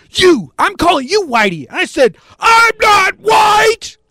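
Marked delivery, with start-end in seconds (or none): 0.1-1.7 high-pitched mocking comeback; acted heckling retort. Heckler answering the man confronting him, heated retort. 2.4-4.0 very high, loud, drawn-out shout; violent denial. Acted. Fed-up man denying the heckler's insult, violent shout. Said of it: "I looked violent".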